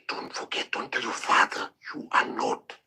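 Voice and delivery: imitating a mother's whisper; mother warning him not to tell his father, whispered. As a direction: hush it like a secret. Mother's secret warning retold.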